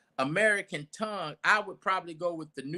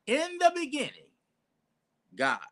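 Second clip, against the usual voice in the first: proclaimed scripture reading; 10 semitones higher than usual. Shown as a demonstration. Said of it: "K J V, King James Version".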